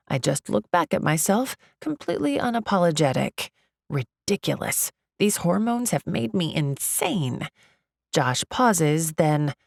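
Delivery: ordinary delivery, nothing marked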